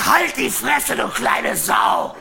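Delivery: loud, slowing furious yell; shout. Retold or imitated. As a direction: roar at the boy. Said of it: "Und hab gesagt". (wütend gebrüllt)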